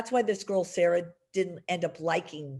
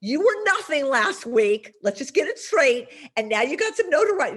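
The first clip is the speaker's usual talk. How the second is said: louder, higher, no-nonsense scolding; blunt reality check; 6 semitones higher, 6 dB louder than usual. Retold or imitated. PR rep telling a pushy client the hard truth, blunt and louder. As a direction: set the client straight, half-mock. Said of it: "Now, of course, I never do that".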